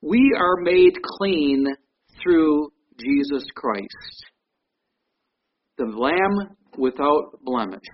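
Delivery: each word spaced out, slow and grave; slow and solemn. Real, not acted. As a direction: lay it down slowly, with weight. Solemn slow statement of point.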